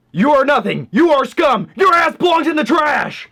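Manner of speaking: high, loud drill instructor tirade; drill sergeant. Acted. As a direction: berate the disc like a recruit. Yelling at old Blu-ray.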